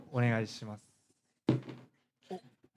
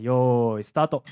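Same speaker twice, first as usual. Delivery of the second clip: start call; 10 dB louder, slower than usual. Real, not acted. 開始の合図を出す. (大きく伸ばしたスタートの掛け声)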